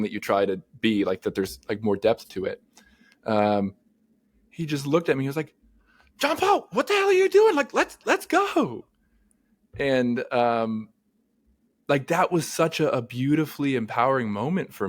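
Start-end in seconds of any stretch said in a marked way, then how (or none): 6.2-8.9 high, loud mock sideline yell; imitated coach yell. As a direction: imitate a coach hollering at a player, pitched way up. Retelling coach yelling from bench.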